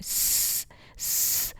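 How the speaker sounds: a soft whistling hiss. Shown as a demonstration. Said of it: "سیٹی کی آواز نکلتی ہے". (سیٹی جیسی س کی آواز)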